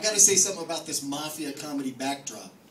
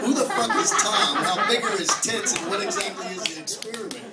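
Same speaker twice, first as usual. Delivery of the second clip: mock outrage; 6 semitones higher, 6 dB louder than usual. Incredulous riff on poster name.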